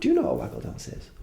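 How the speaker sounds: quick, high, breathy aside to viewers; to the camera. Shown as a demonstration. Turning to ask the viewers at home, quick and hushed. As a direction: turn and ask the people at home, hushed and fast. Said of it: "Talking to the camera".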